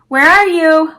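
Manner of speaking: calling out loud into the trees; shouting. Acted. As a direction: shout it toward the woods. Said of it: "Wes shouted towards the trees".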